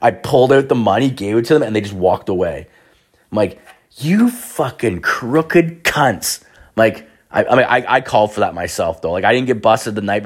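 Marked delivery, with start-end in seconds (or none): 4.0-6.4 slow, drawn-out, higher angry curse; angry muttered curse. Retold or imitated. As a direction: spit each word out slowly in disgust. Cursing the cops who robbed him.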